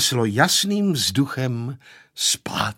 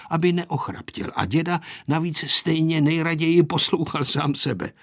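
usual speaking voice

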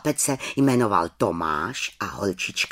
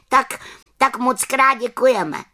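put-on high, louder grateful children; children's voice; 12 semitones higher, 5 dB louder than usual. Children thank the little witch, high voice. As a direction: thank her like eager kids.

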